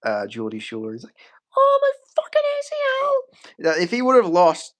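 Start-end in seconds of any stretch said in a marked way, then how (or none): 1.6-3.1 very high-pitched mock cry of alarm; Darren Till reaction. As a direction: squeal it like a hammed-up injured fighter. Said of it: "that was his reaction when he got dropped".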